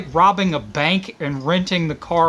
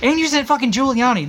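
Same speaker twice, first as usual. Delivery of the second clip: ordinary talk, nothing marked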